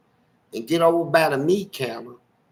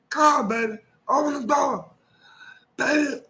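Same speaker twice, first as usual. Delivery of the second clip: put-on higher, whiny drunk begging at the window; drunk husband pleading; 9 semitones higher than usual. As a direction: plead to be let in, slurring.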